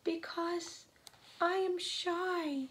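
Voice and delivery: small, high, timid character voice; trembling, shy voice. A narrator's reading. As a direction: answer shyly, high and slowing down. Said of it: "a trembling voice says".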